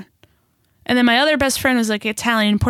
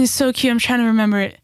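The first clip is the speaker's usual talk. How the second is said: usual speaking voice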